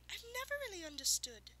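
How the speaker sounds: musing. A narrator's reading. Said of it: "the unicorn mused".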